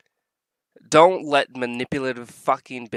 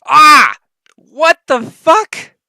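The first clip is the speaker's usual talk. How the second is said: very high, very loud outburst, last words slow; startled scream of disbelief; real shocked shout; shocked yell; 13 semitones higher, 13 dB louder, much slower than usual. Real, not acted. Shocked by the clip.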